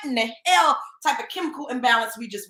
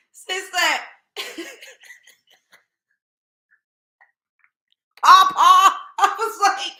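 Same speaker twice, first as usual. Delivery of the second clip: breaking up in high laughter; can't get the words out for laughing; real laughter over the clip; 6 semitones higher, 8 dB louder, slower than usual. Real, not acted. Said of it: "That was hilarious".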